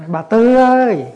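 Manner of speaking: calling out high and loud across the yard. Retold or imitated. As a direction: yell her name from the gate. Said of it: "kêu lên nữa".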